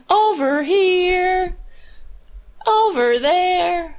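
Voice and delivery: high, slow, drawn-out singsong; mock singsong. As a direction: croon it like a mocking chant. Mocking the inauguration singers.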